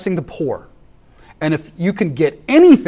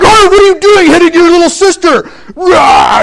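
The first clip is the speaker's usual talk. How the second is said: much higher, louder hammed-up scolding shout ending in a roar; mock angry yell; 12 dB louder than usual. Father mock-yells at his son for hitting his little sister, hammed-up shout. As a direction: play the furious dad for laughs, then roar.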